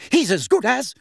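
high, loud, fierce retort. A narrator's reading. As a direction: fire back, voice right up. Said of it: "said Missus Weasley fiercely".

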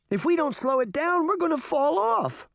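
scared cry. A narrator's reading. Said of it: "Daphne cried".

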